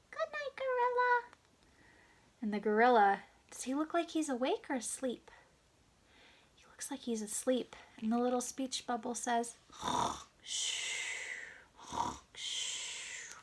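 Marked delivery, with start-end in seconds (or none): 0.1-1.3 tiny, very high mouse voice; little mouse. Acted. Little mouse to the gorilla at bedtime, tiny high voice. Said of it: "little mouse says". 10.4-13.3 snorting in-breath, long shushing out-breath; snoring. Acted. Sleeping gorilla in bed, snoring in and whooshing out. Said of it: "because I bet gorillas snore".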